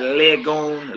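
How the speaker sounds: lagoon slowly as LEG-goon; wrong E vowel. Shown as a demonstration. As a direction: demonstrate the mistake, lagoon with 'leh' in front. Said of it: "not lagoon".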